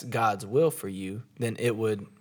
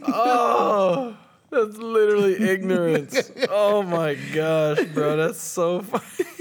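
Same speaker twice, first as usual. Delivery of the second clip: laughing; 8 semitones higher, 6 dB louder, slower than usual. Real, not acted. Reacting to joke.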